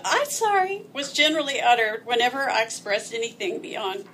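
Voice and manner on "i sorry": put-on high babyish voice; baby talk. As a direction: say it like a toddler. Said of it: "unable to form the contraction".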